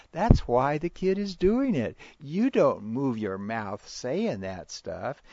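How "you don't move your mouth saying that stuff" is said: normal talking voice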